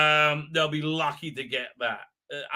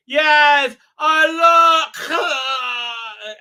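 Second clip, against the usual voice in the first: high, loud mock tearful cry; imitating the Doctor; 9 semitones higher, 10 dB louder, much slower than usual. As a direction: wail it as the Doctor cut off mid-line. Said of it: "I think she's gonna say".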